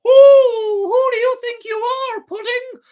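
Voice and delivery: shrieking, very high, loud jeer; a cheeky puppet shouting scorn; mocking puppet mouth. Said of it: "started to laugh and deride him".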